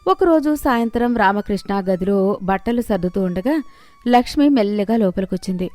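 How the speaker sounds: ordinary delivery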